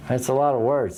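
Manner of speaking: usual speaking voice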